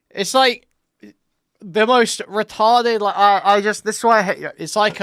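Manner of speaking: venting in disbelief, loud; loud frustrated tirade; loud exasperated rant. Real, not acted. Heated rant about the argument.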